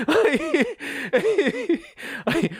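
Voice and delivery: laughing high-pitched; high laughter. Real, not acted. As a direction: burst into squealing laughter.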